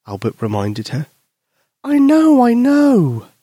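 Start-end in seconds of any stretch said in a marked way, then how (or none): 1.9-3.3 put-on high, louder impatient child; impatient girl. Susie cutting off her friend, impatient high voice. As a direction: brush off the warning, high and loud. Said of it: "Susie replied impatiently".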